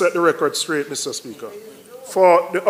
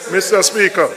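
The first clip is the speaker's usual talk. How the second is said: ordinary delivery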